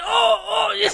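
very high shouted oh oh; excited shout. Retold or imitated. Brother cheering at his sister's coming out, shouting. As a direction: shout it with glee. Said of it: "Jabron was like".

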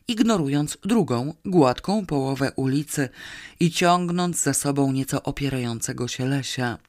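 ordinary delivery, nothing marked

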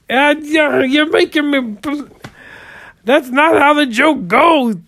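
high, loud exasperated scolding. Real, not acted. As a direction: scold her, voice cracking high with frustration. Exasperated comedian scolding Barbara.

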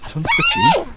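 high, loud startled question; sudden panicky high pitch; alarmed. Acted. Panda suddenly coming at them.

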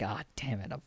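mock defeated mutter. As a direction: grumble that he's been beaten. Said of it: "just being like".

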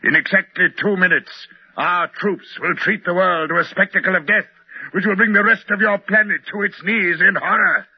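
high, mounting villain's tirade; menacing proclamation. Acted. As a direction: proclaim doom, climbing in pitch to the last word. Villain proclaims the coming attack.

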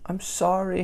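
put-on mild plaintive cry of a scolded child; crying apology. A narrator's reading. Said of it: "Harriet cried".

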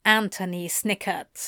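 usual speaking voice

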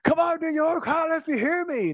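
high hammed-up wrestler call to the crowd; mock Steiner hyping up the arena; imitating Scott Steiner. Retelling his debut to co-host, hammed-up Steiner crowd call. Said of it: "and then goes like".

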